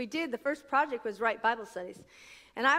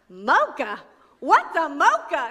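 louder, puzzled echo of mocha; loud incredulous what's a mocha; baffled mocha question; 7 dB louder, slower than usual. Retold or imitated. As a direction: play the confused Southern waiter. Staff baffled by mocha order.

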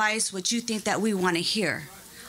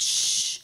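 whispered shh. Retold or imitated. Imitates camera being cut off.

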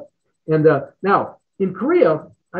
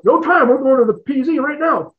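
commander; 9 semitones higher than usual. Retold or imitated. Commander ordering pilot off.

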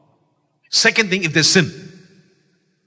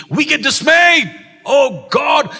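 much higher, louder and slow; impassioned cry; 8 semitones higher, 6 dB louder, slower than usual. Real, not acted. Preacher lamenting the church's fear, loud cry.

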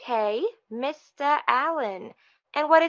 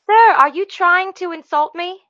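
higher, louder affronted challenge; indignant; 5 semitones higher, 9 dB louder than usual. Acted. Receptionist to caller who questioned the spelling, indignant. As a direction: take sharp offence at the caller.

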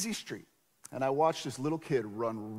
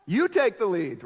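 retold yell; 5 semitones higher, 7 dB louder than usual. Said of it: "actually yelling at 'em".

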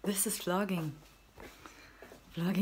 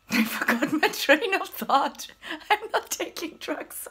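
giddy spaced-out; 10 semitones higher, 7 dB louder, slower than usual. Real, not acted. Spacey giggly talk.

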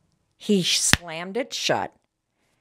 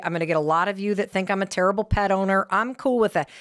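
normal talking voice

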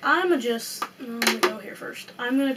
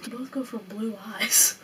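whispering; 3 semitones lower, 7 dB quieter than usual. Real, not acted. Whispered remark.

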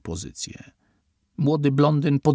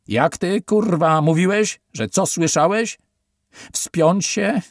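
much higher, loud, sneering question; menacing interrogation; 8 semitones higher, 7 dB louder than usual. A narrator's reading. Officer grilling Steven about the password, loud and sneering. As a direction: corner him with the question.

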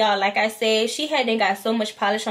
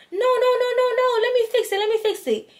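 much higher, pleading mock voice of the nail tech; imitating pleading tech; 12 semitones higher than usual. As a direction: beg like a worried tech who wants another try. Nail tech begging to fix it.

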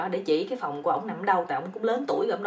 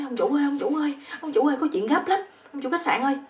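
higher, urgent mock shout; panicked calling; 7 semitones higher, 4 dB louder than usual. Flustered guest to hotel owner about an emergency, acted panicked calling. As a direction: call out in a hammed-up fluster. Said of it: "thằng này nó quýnh rồi".

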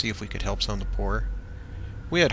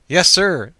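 nervous; 6 semitones higher, 9 dB louder than usual. A narrator's reading. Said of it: "who looked nervous".

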